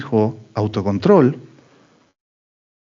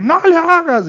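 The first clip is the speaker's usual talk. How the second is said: shrill mock wife, much higher and louder; mimicking wife; 4 dB louder, slower than usual. Father imitating his wife stopping him, high mock squeal. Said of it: "mi mujer".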